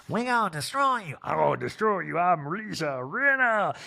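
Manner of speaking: mock menacing Rinna. Voicing Rinna's threat.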